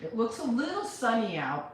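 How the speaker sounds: normal talking voice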